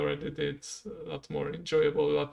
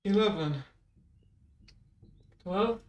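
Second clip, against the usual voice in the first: counting out each squat, raised; 6 semitones higher, slower than usual. Real, not acted. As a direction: call the reps mid-exercise. Counting reps while doing squats.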